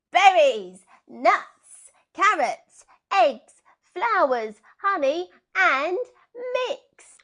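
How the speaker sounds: high, loud and slow list; shouted instructions. Acted. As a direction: call out each ingredient to the flock. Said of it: "shouted instructions".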